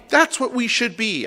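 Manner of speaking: high, slow emphatic declaration; retold fervent insistence. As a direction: drive the point home as the impassioned member. Member's emphatic conclusion, acted.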